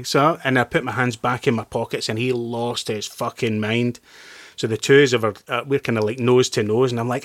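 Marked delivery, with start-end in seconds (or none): none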